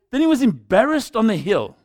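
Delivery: ordinary talk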